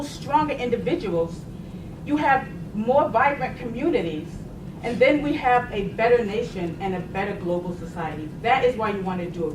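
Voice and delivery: ordinary talk, nothing marked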